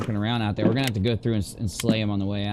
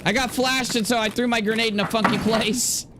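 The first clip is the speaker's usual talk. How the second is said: excited; 10 semitones higher than usual. Real, not acted. Excited retelling right after.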